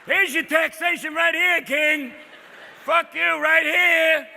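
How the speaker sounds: high, loud taunting shout; defiant rebel. Acted. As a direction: shout defiance at the king, stretching the end. Boston rebels defying the king.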